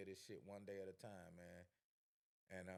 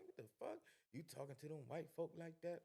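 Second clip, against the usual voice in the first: retelling his stunned reply to the other guys; incredulous; 8 semitones higher than usual. Retold or imitated. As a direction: throw their words back at them in disbelief. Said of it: "I'm like".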